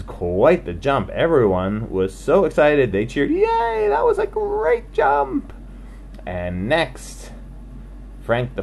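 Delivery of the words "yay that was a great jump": high, amazed little voices; amazed crowd. Acted. Animals marvel at the jump in high amazed voices. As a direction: gasp it out like a wowed crowd.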